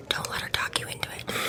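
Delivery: hushed, voiceless whisper; whispered advice. Real, not acted. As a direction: drop to a whisper as the advice ends. Mock-secret advice, whispered.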